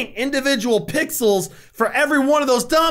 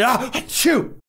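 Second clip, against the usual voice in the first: mock sneeze; slower than usual. Imagining himself setting dominoes up, then a mock sneeze. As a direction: pretend sneeze, drawn-in ah then burst.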